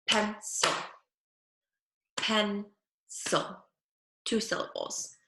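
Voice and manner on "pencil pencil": pencil said clearly, sounded out; modelled word. Shown as a demonstration. Speech teacher modelling pencil for pupils. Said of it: "we'll start with".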